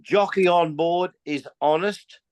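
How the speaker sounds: ordinary delivery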